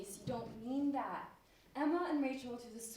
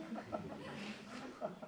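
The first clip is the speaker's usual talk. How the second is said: soft embarrassed laugh; 6 dB quieter than usual. Acted. [name] laughs at child's question.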